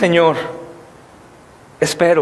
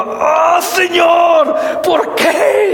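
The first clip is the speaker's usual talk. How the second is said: retold yelling, pitched way up at the heavens; angry shout to God; 8 semitones higher, much slower than usual. Pastor reenacting yelling at God alone in the van, high angry cry. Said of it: "Y gritaba de coraje".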